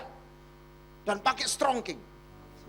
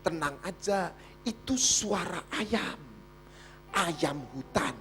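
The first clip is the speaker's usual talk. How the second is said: 1 reassuring husband; 6 semitones lower, 6 dB quieter, slower than usual. Retold or imitated. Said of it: "husband calming wife".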